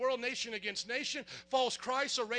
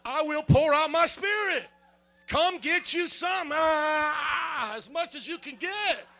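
loud crying preacher's shout; fervent shout; 4 semitones higher, 7 dB louder, slower than usual. Real, not acted. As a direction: let it burst out, louder and higher. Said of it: "come on, is it not still there?".

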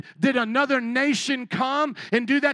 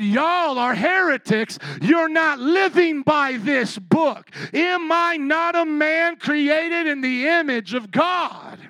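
defiant shout; 6 semitones higher, 6 dB louder, slower than usual. Retold or imitated. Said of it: "put it back in their slave masters' faces".

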